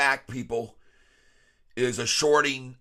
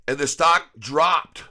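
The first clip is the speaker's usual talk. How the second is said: incredulous; 3 semitones higher, 6 dB louder, slower than usual. Real, not acted. Punchline of the rant.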